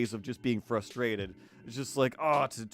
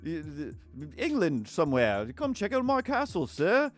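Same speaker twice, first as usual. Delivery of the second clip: higher, hammed-up posh British voice; posh British accent; 5 semitones higher than usual. Castle owner greeting a sir, posh British accent. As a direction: put on a mock upper-class British accent. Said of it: "one British person's like".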